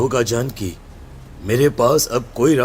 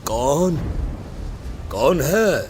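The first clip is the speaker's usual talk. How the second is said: nervous call; 4 semitones higher, slower than usual. Acted. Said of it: "धीरे से आवाज देते हुए पूछता है".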